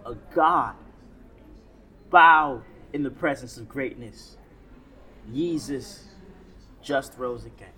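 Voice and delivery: rhythmic spoken word performance; spoken word poetry. Acted. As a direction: perform it like a slam poem.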